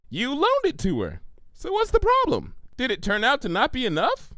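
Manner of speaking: genuinely baffled questions; puzzled Pinkie. Acted.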